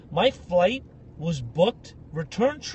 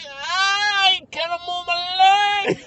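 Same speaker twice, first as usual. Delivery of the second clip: high, long, drawn-out mock wail; hammed-up operatic cry of agony; wailing Italian drama queen; 10 semitones higher, much slower than usual. Italian guy answering with no sympathy given, operatic wail.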